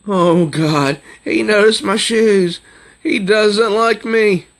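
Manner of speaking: put-on panicked girl's thought. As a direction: voice her worry as a nervous teen girl. Girl voiced in comic thoughts.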